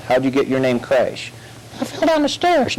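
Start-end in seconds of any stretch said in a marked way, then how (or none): 1.8-2.8 high silly puppet answer. Acted. Dummy answers the officer, high puppet voice. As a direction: pipe it up innocently.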